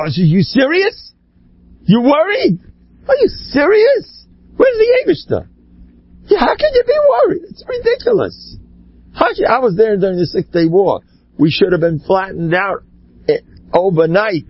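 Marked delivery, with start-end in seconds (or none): 0.2-8.6 very high-pitched, squealing disbelief; incredulous rebuke. Retold or imitated. Retelling his answer to a worried visitor from Israel, incredulous. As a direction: voice shoots right up, scandalized.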